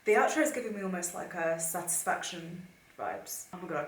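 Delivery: ordinary delivery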